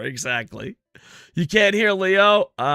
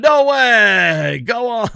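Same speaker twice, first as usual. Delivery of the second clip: mock amazement; 6 semitones higher, 7 dB louder, much slower than usual. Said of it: "I'm gonna go with you".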